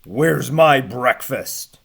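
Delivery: loud, slow demand from the giant; giant bellowing. Acted. Giant bellowing at caged Hans for his breakfast. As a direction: bellow it out slowly like a hungry giant. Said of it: "Barefootus bellowed".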